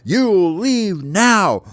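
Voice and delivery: high, loud, slow order; triumphant little knight laying down the law; commanding baby bird. Acted. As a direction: stretch each word into a ringing command.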